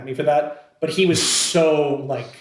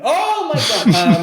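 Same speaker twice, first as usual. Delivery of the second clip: drawn-out, high mock astonishment; exaggerated, stretched-out gasp of recognition; amazed reaction; 12 semitones higher, 5 dB louder, much slower than usual. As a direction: act out his amazement, slow and pitched up. Acting out the man's amazed reaction.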